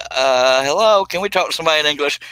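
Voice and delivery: exasperated. Retold or imitated. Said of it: "I was like".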